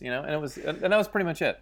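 ordinary talk

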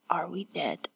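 faint, breath-only question; weak croak. Acted. Wounded woman waking to her companion, weak whisper. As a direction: whisper it softly like someone badly hurt.

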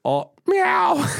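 high, sing-song meow to the cat; feigned innocence, high cat-calling voice; mock sweet cat call. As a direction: coo at the cat in a falsetto.

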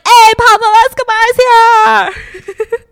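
high, loud, slow party shout; a loud, high, drawn-out drunken cheer. Retold or imitated. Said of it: "The Russian mafia is like".

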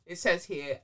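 normal talking voice

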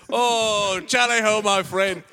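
loud, high, hearty pirate greeting; hollering pirate. Acted. Pirate hailing a new friend, hollering. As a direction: bellow a hello across the deck.

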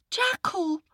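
put-on high, earnest little boy's voice; a small boy calling for help; young boy. Boy pleads with jackal.

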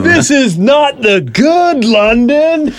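high, slow taunting heel voice; wrestling heel taunt. Retold or imitated. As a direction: sneer it at the crowd. Quoting wrestlers taunting the crowd.

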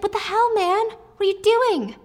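indignant protest. Retold or imitated. Wylan protesting.

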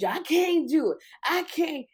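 high, loud whiny protest; whiny complaint. Retold or imitated. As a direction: whine like you're quitting. Kim quoted whining.

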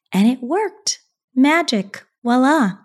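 lifted, playful flourish; playful triumph. Real, not acted. Wife showing off her new calm to listeners, playful. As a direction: announce it like a magic trick. Triumphant punchline after story.

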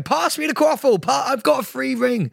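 high-pitched, urgent call for the ball; player's excited call. Acted. Acting out a Quidditch player for a reluctant friend, high urgent call. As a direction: call out high as if running mid-game. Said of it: "I want you to be running, going".

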